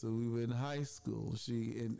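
ordinary delivery

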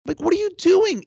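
disbelieving, worked-up rant; high loud incredulity; incredulous rant. Real, not acted. Ranting about the seat.

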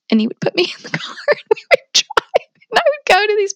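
laughing through the words, fast and breathless. Real, not acted. Telling the podcast host about her husband, laughing. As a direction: giggle it out quickly as you tell it.

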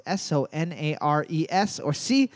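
normal talking voice

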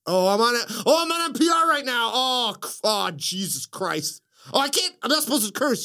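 mock-panic at cursing live on air; flustered Joaquin impression. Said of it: "He's like".

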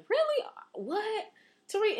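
playing dumb with a high, shocked voice; mock surprise. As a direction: act stunned by the news. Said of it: "I'd be like".

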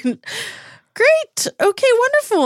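strained fake delight. Niles pretending to be happy, very high and strained. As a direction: force out the good words through gritted teeth.

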